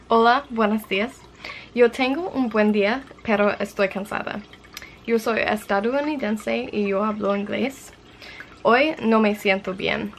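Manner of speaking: careful, deliberate learner's read-aloud in Spanish; reading a journal entry in Spanish. Acted.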